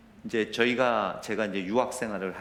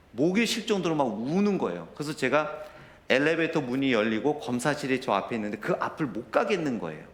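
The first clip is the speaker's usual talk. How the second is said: usual speaking voice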